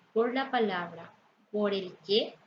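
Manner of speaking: normal talking voice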